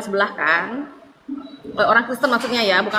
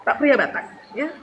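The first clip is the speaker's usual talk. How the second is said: ordinary delivery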